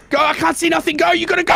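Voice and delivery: high frantic shout; panicked shouting. Real, not acted.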